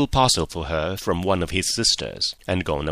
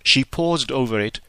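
plain reading voice

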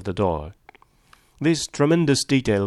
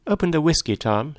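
higher-pitched, mildly bossy order; a woman's command, voiced higher; giving an order; 6 semitones higher than usual. A narrator's reading. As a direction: order him about lightly. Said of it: "she ordered".

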